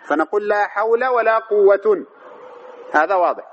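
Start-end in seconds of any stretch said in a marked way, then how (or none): 0.5-2.1 "حول" ending in -a, "قوة" in -un; drawn out, fatha on "حول", nunated damma on "قوة"; fatha then tanwin. Shown as a demonstration. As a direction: say hawla for "حول", quwwatun for "قوة", slowly. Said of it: "نعمل الأولى ونهمل الثانية".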